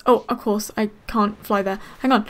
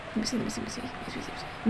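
softer, unvoiced muttered search; whispering to herself; 13 dB quieter, much faster than usual. Real, not acted. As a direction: whisper it under the breath while looking. Searching for hidden Pokémon.

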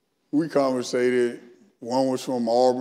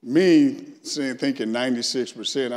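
ordinary talk

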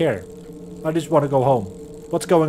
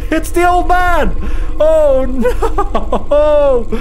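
loud, high alarmed yell; panicked shout; 7 semitones higher, 6 dB louder, much slower than usual. Real, not acted. Player being chased by the old man, yelling in panic.

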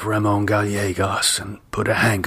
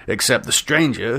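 ordinary delivery, nothing marked

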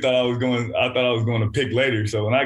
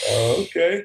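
higher, drawn-up "oh, okay"; let-down acceptance; 6 semitones higher than usual. Retold or imitated. Player retells learning his idol left, mock let-down. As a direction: act out mildly thrown acceptance.

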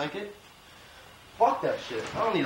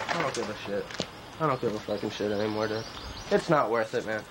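much lower, deflated, giving up; defeated; 10 semitones lower, 3 dB quieter than usual. Acted. Broke designer giving up on his clothes, deflated. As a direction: let the air go out of you.

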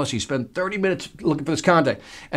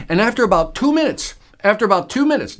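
two minutes lifted higher and pushed out, then repeated; stress on two minutes; 5 semitones higher than usual. Shown as a demonstration. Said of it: "notice I'm emphasizing two minutes".

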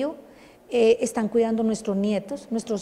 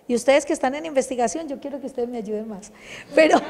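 ordinary delivery

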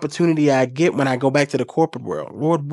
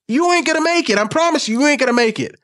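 emphatic warning; 9 semitones higher than usual. Real, not acted. Warning selfish money-chasers.

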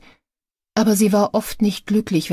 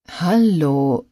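drawn out. A narrator's reading. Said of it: "sagte er gedehnt".

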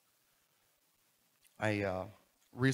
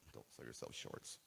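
low, hushed, almost voiceless; muted aside; 12 semitones lower, 14 dB quieter than usual. Real, not acted. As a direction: drop it to a soft throwaway murmur. Botched saying, redone after a joke.

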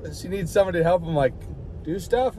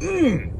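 mock yummy hum; 10 semitones higher than usual. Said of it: "I was like".

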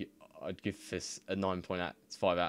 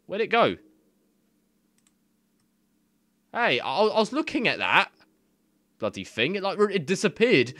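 high, loud exasperated yelling; real annoyed outburst; frustrated shout; 11 semitones higher, 10 dB louder than usual. Real, not acted. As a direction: yell at the vanished text. Text vanished, yelling at screen.

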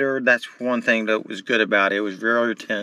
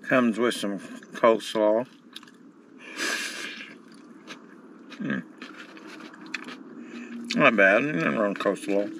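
talking while eating; chewing; 5 dB quieter than usual. Real, not acted. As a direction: mouth partly full. Seen 2: hand-to-mouth eating before; jaw working over tray during words.

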